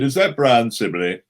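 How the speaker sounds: loud put-on telephone enquiry; lightly disguised caller's voice, projected; Christopher Lee. Caller phoning Brian, poorly disguising his voice. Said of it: "I was disguising my voice".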